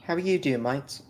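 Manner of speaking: a hint of a British accent; light cheerful British hello; mock British greeting. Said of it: "What part of England is that".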